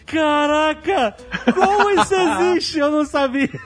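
high, drawn-out mock astonishment; amazed child. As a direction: act out a kid's wide-eyed wonder, stretching the words. Criança deslumbrada com brigadeiro, encenada. (espanto infantil encenado, agudo e arrastado)

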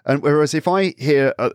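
usual speaking voice

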